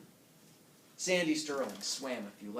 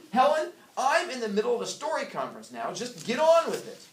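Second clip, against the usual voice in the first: loud, high impatient snap; husband brushing off his wife mid-meeting; 10 semitones higher, 9 dB louder than usual. Acted. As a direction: cut her off, get on with it.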